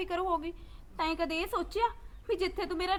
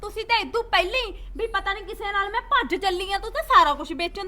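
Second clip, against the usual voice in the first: much louder, pleading yell at her mother; angry shout; 8 dB louder than usual. Acted. Daughter yells at mother over land.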